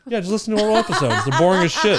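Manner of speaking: loud, high, laughing self-mockery. Real, not acted. Joking about their boring podcast.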